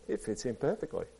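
very soft, awed line; hushed amazement. A narrator's reading. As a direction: say it in quiet wonder. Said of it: "Ape was amazed".

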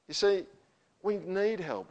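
rising, emphatic pulpit delivery; fervent preaching. Acted. Pastor urging the congregation to seek grace, fervent. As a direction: plead for grace with growing force.

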